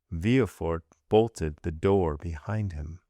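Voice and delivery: plain reading voice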